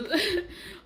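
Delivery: short high burst of laughter. Real, not acted. Daughter laughing off her mother's message, real laugh. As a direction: laugh it off in disbelief.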